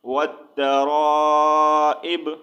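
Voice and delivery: long held را, then اب stopped on "b"; recited وت را اب, heavy را, ending "-ib"; pausal stop ending. Shown as a demonstration. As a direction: stretch را and cut اب off with no final vowel. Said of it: "اگر یہاں پر وقف کریں گے".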